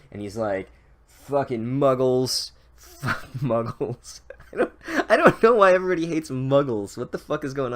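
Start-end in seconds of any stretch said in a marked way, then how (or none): 1.1-4.2 drawn-out, sneering brute voice; slow sneering brute. Retold or imitated. Gregor sneering about Muggles, slow brute voice. 4.5-7.7 laughing, quick and higher. Real, not acted. Narrator cracking up at the Muggle hate, laughing. Breaks off laughing at own bit.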